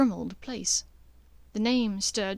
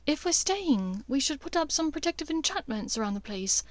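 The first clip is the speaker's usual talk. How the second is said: Hermione lists the spells, focused; practical Hermione; 5 semitones higher than usual. Acted. Hermione telling Harry the protective spells, higher and deliberate.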